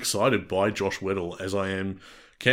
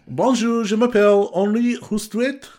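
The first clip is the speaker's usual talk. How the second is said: higher, louder hammed-up French accent; a schoolboy French accent, hammed up; 11 semitones higher, 5 dB louder than usual. Asked by his co-host to try French, a hammed-up French accent. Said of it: "What about French?".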